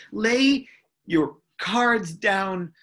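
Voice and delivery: ordinary talk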